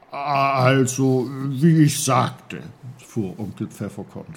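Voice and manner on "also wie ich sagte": put-on louder, slow, deliberate old-man voice; uncle clearing his throat. The uncle resumes his explanation, slow and louder. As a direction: Uncle Pfefferkorn carrying on pompously.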